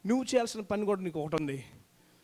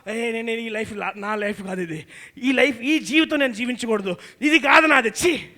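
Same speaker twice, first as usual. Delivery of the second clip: voicing the soul rising louder to a cry; 7 dB louder than usual. Preacher voicing the stirred soul's protest, rising to a loud cry. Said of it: "అని బయటికి రావాలంట".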